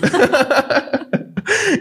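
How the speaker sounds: high amused laugh. Real, not acted. Host joking about viewers who only criticize, high laugh.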